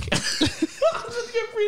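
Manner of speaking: squeaky giggle fit; high burst of laughter. Real, not acted. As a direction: laughs at own blunder. Fumbles line, cracks up.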